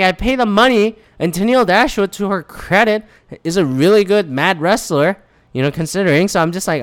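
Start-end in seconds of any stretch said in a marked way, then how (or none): none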